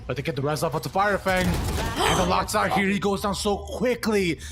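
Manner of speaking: rising excited play-by-play; esports caster. Acted. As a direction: build tension on the call.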